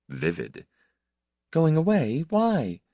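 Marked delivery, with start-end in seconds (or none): 1.5-2.8 Going away? rising high in surprise, then a higher Why; surprised question. A narrator's reading. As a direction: catch his words and throw them back, startled. She echoes his news, surprised.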